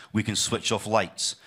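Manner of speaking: ordinary talk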